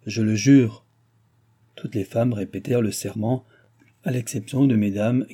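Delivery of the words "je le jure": mildly solemn, louder oath; lightly majestic, projected declaration. A narrator's reading. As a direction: swear it with a touch of majesty. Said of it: "et dit majestueusement".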